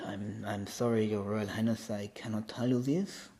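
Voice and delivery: soft-spoken Frenchman's English; French accent. Acted. Royal guard answers Odin's question, French accent. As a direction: apologize quietly in a French accent. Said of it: "you got the French accent in".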